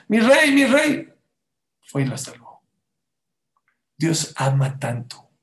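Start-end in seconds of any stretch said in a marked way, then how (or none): 0.1-1.1 high, slow, loud scream; a slow, shrieking call for help; screaming for the king. Retold or imitated. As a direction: cry out desperately for the king. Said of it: "Y empezó a gritar".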